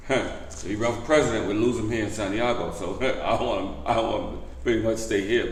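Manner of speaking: ordinary delivery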